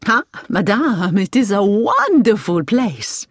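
slow, savoured enthusiasm in a French driver's voice; enthusiastic. A narrator's reading. Driver tells Hilary about the hospital, enthusiastic. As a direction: gush with pride, stretching the words. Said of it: "the driver said with enthusiasm".

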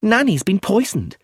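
put-on high, loud girlish thrill; delighted by the news; excited. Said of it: "Her eyes shone with excitement".

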